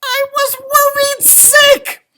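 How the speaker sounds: high, loud leprechaun yell; loud high worried yell. Acted. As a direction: shout it, worried and scolding. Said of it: "I yelled".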